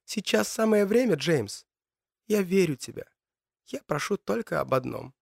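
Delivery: lightly voiced young woman, pleading. Matilda's voice in his mind.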